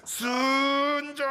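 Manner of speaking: strained, drawn-out grunt of effort; forced effort. Shown as a demonstration. (억지로 용쓰는 외침)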